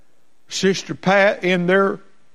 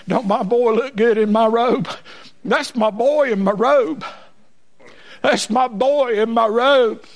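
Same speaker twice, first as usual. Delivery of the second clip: raised, ringing voice of a proud daddy; proud father; 6 semitones higher than usual. Acted. Father to mocking crowd about his son, proud declaration. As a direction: show off your boy in a high preaching voice.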